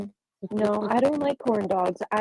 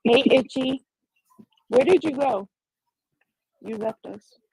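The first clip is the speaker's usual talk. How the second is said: talking through a mouthful of food; mouth full; 3 semitones higher, 4 dB louder than usual. Real, not acted. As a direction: speak around a bite of food. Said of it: "I'm talking with food in my mouth".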